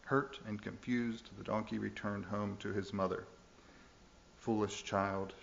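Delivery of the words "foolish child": usual speaking voice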